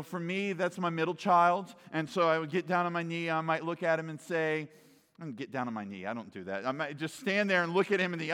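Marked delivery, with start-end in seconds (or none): none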